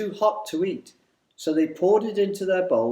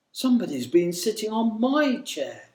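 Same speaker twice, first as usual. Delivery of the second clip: lightly voiced mother bear, higher than the narration; gentle middle-sized bear, pitched up a little; 6 semitones higher than usual. A narrator's reading. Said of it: "she said in her gentle".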